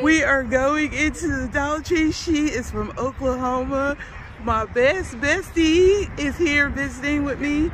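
bubbling over with excitement; excited. Real, not acted. Excited about friend visiting.

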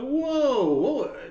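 loud, high, startled whoa. Retold or imitated. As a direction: yelp it as if just hit hard. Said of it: "I'm like".

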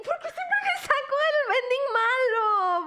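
high, loud, laughing complaint; laughing despair. Real, not acted. Streamer to chat about always getting the bad ending, laughing and shouting.

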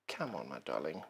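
very soft, low endearment to the machine; kind coaxing. Real, not acted. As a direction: sweet-talk it gently, almost under your breath. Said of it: "as kind to their sewing machines".

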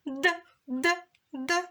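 д repeated high and crisp; sound demo. Shown as a demonstration. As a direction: tap the д sound out clearly three times. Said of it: "Так само і звук". (дзвінкий звук д)

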